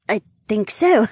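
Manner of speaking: put-on drunk and hiccuping; slightly drunk woman; tipsy hiccup. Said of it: "agreed with a slight hiccup".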